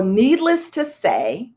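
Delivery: ordinary delivery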